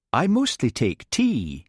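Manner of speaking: slow, soft Scottish Highland lilt; gentle Highland lilt. Acted. Hamish answering the Americans, soft Highland accent. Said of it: "he said in a soft Highland voice".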